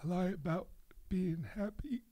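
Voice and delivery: choked, wavering voice near tears; about to cry. Real, not acted. Joking about lying while on the verge of tears. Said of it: "sounds like you're about to cry".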